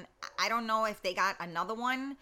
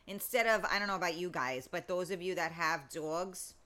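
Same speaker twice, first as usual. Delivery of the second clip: ordinary talk, nothing marked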